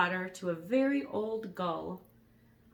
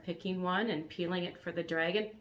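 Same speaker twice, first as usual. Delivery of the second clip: ordinary delivery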